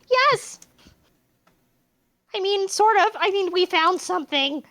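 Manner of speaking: put-on excited girl voice. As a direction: squeak out the news excitedly. Clover voiced, high.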